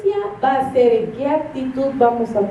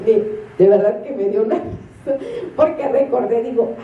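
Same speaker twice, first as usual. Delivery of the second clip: ordinary talk, nothing marked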